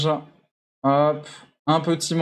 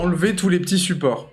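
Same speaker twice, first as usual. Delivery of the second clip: ordinary talk, nothing marked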